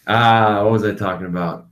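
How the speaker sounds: usual speaking voice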